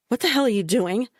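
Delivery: fast, high alarmed cry; panicked protest; alarmed outburst. A narrator's reading. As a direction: snap it out in alarm. Being tied to bed.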